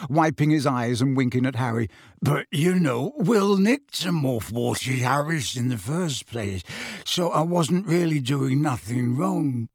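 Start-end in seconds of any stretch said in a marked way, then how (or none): 2.2-9.7 slow, wheedling crook's voice; roguish self-excuse. Acted. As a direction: excuse yourself like an unrepentant rogue.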